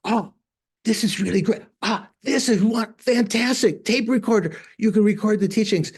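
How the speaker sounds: lifted, wowed exclamations in a retelling; excited delight. Retold or imitated. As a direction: imitate someone thrilled by a new gadget. Retelling lama's delight at gadget.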